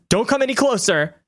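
a high, frightened cry to keep away; an unseen stranger warns the intruders off, high and alarmed. A narrator's reading. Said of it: "you hear a voice cry out".

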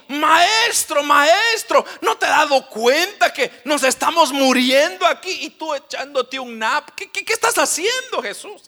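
high, loud alarmed yelling; Peter shouting in alarm. Acted. Peter waking Jesus in the storm, alarmed shouting. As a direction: shout at the sleeping master in panic. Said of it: "Y dijo".

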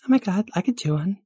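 put-on high, excited girly voice; volunteer in a high girlish gush; high-pitched eager girl; gushing valley girl, eager.

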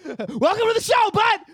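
loud, high on-air welcome; loud, high hollered greeting; playful shout. Real, not acted. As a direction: call out a cheeky welcome to a listener. Greeting a possible listener playfully.

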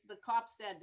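ordinary talk, nothing marked

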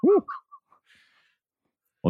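ordinary talk

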